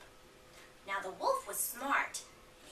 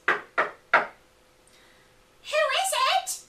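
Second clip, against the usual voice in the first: put-on loud, much higher old granny call; startled granny; 10 dB louder than usual. Granny calling to the knock at her door, loud and high. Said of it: "cried Granny".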